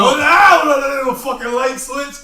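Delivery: loud denial. Real, not acted. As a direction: shout him down, insisting.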